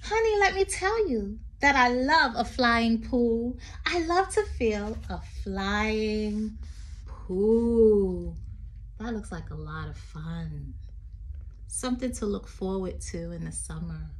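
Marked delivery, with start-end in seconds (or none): 0.0-8.3 sing-song read-aloud poem voice. Acted. Teacher reading a poem to children, lilting.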